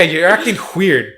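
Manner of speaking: ordinary delivery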